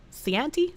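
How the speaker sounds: "Chianti" tried softly as see-AN-tee; unsure pronunciation. Shown as a demonstration. Streamer giving up on a wine name, "Chianti" guessed as see-an-tee. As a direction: say "Chianti" with an s start and a short a, questioning. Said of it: "I don't know how to pronounce that".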